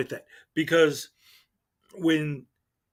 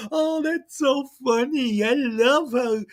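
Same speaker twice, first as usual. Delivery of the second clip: much higher, slow, syrupy fan voice; mocking gushing fan; 8 semitones higher than usual. Mocking fans praying the auditor in comments. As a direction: coo it like a dopey fan. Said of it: "are all like".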